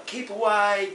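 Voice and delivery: eerie ghost warning. Retold or imitated.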